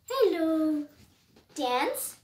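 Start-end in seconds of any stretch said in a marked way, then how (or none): none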